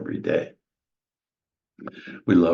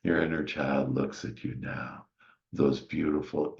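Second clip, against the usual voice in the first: normal talking voice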